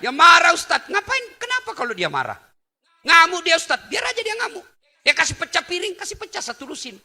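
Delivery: high, loud, laughing back-and-forth; hammed-up dialogue, high and loud; lively comic preaching. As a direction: play it up to get the crowd laughing. Preacher acts out dialogue with husband.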